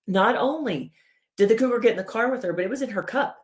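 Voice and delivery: scandalized body. Acted. Body reporting the cougar in her cup, mock scandalized. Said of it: "your body's like".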